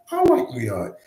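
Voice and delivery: very high falsetto answer, hammed-up; squeaky mock reply. Streamer voices the migrant answering, mock falsetto. Voicing imagined dialogue reply.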